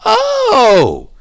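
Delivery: high squeaky voice of sudden understanding; realizing learner. Retold or imitated. Learner opening a grammar book, high surprised aha voice. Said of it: "you're like".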